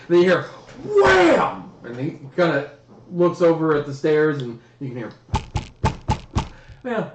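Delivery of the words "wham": a sudden high shouted wham; sharp imitated crash; mock slam noise. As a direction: bang it out like a door slamming. Sound of door slamming.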